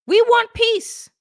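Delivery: put-on high, loud French-accented "We want peace"; mock French Macron. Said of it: "this is what Macron says".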